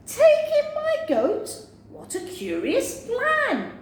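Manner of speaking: very high, astonished; old woman, astonished. Acted. Old woman astonished at the wise man's advice, very high. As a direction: squeal the question in disbelief.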